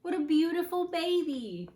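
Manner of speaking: high, delighted exclamation; very excited. A narrator's reading. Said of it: "was very excited".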